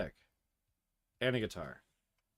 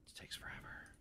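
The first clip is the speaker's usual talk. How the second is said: breathy whispered grumble; 29 dB quieter, faster than usual. Real, not acted. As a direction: hush it under your breath. Grumbling aside while grinding.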